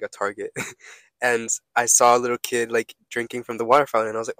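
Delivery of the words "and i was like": ordinary delivery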